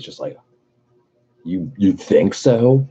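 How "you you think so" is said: stunned disbelief. Retold or imitated. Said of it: "had a fucking O face".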